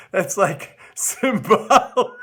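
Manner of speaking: slow, breaking into laughter; laughing. Real, not acted. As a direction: giggle through the word. Laughing at tax plan.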